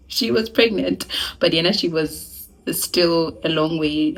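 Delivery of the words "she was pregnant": usual speaking voice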